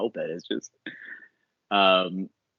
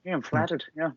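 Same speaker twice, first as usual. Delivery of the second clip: put-on failed Fury accent; 7 semitones higher, 3 dB quieter than usual. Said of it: "I can't do that accent".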